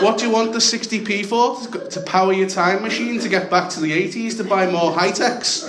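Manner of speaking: high-pitched scornful questioning; mocking retort. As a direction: taunt him in mock outrage. Comic retort in retold fight.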